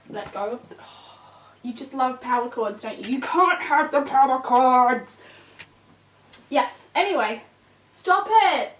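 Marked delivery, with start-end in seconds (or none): 3.2-5.2 loud slowed scold; firm telling-off. Real, not acted. Owner scolding puppy chewing power cords, loud. 8.0-8.7 loud higher sharp command; sharp stop. Real, not acted. Owner stopping puppy chewing, sharp command.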